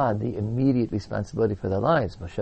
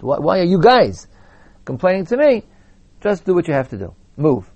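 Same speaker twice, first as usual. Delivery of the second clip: mock exasperated God; 5 semitones higher, 5 dB louder than usual. God rebukes complainers.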